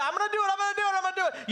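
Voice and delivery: high, panicky mock kid voice; frantic child. Child answers dad about obeying Mom, high frantic voice.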